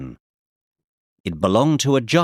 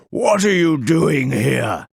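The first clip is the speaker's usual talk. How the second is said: slow, gruff giant; 4 semitones higher than usual. Acted. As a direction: growl it like a giant. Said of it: "he asked in a gruff voice".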